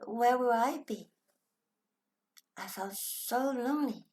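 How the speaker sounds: ordinary talk, nothing marked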